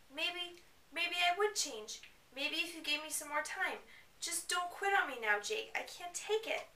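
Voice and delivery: hammed-up high whiny teen girl; whiny Bella. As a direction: plead up high and pathetic.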